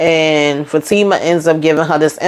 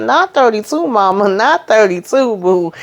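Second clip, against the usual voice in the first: higher, teasing protest at the mom; higher mocking no-way; incredulous retort; 6 semitones higher than usual. Real, not acted. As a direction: call out her age limit in disbelief. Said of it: "I said".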